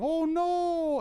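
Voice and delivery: squeaky high panicked cry; tiny screaming man. Retold or imitated. Streamer voices a tiny figure on the circuit board, squeaky high panic. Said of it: "little screaming man who's going".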